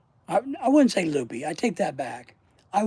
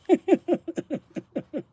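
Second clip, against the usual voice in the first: high bursting laugh; 11 semitones higher, 3 dB louder than usual. Real, not acted. Laugh burst after rant.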